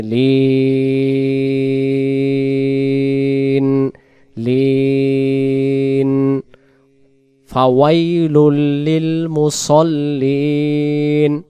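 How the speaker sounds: very slow stretched tajweed chant; slow melodic verse recitation; Quran recitation. Shown as a demonstration. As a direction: draw out the nasal ending melodically. Recites the verse after spelling.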